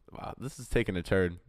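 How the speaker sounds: ordinary talk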